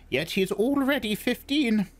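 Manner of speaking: put-on high, wheezy old crone; old witch, thin and reedy; breathless old person. Old crone speaking to mother.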